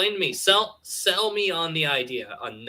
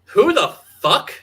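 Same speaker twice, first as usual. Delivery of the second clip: mock outrage; 4 semitones higher, 5 dB louder, slower than usual. Mock outrage over a name.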